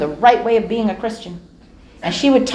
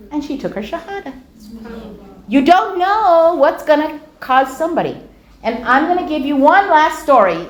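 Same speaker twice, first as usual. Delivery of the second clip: usual speaking voice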